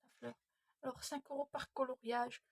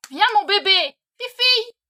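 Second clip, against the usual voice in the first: loud, high call to the dog; 8 semitones higher, 21 dB louder than usual. Real, not acted. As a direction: call the dog from across the house. Calling the dog loudly. (appel fort et aigu au chien)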